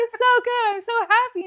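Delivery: high, loud emotional gush; overwhelmed happiness, retold. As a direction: re-enact insisting through happy tears. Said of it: "but, like, happy".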